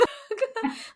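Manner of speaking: high crying laugh; teary high laugh; tearful laugh. Real, not acted. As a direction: laugh through tears, pitch up high. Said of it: "That visual".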